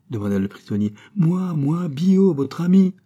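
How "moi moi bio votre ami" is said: high, loud, eager answer; raised, urgent reassurance; excited calling. A narrator's reading. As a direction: call out who you are to a friend behind a door. Rescuer calls to prisoner friend.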